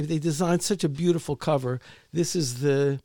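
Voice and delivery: ordinary talk, nothing marked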